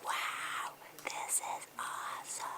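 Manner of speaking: silent scream of joy; whisper yelling. Shown as a demonstration. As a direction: shout it, but only in a whisper. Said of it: "Practice your silent screams".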